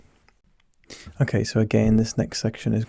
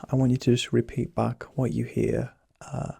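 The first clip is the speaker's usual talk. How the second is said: ordinary delivery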